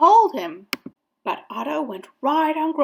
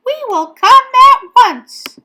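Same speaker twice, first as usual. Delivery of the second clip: high, loud, emphatic promise; urgent shout; 13 semitones higher, 13 dB louder than usual. A narrator's reading. Firemen answering the policeman's radio call, urgent shout. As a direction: shout back ready for action.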